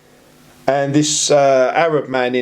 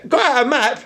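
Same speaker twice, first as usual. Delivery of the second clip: laughing retold officer; 9 semitones higher than usual.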